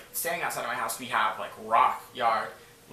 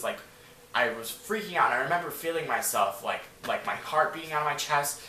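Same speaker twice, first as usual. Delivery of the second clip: ordinary talk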